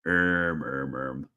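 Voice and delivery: ordinary delivery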